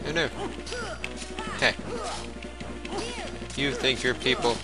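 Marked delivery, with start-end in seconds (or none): none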